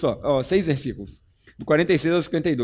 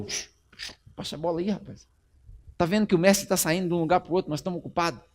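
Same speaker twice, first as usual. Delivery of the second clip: much softer shushing rebuke; hushing; 7 dB quieter than usual. Acted. Disciples shushing the blind man, soft and scolding. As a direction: hush the beggar down. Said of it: "alguns chegaram perto dele e falaram".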